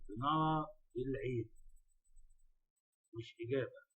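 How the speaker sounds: normal talking voice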